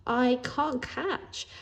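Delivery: very slow, hesitant; timid explanation. Acted. Shy boy explaining to Jake, very slow. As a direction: drag each word out shyly. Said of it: "I explained".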